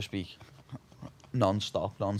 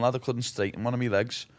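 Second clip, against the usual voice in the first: ordinary delivery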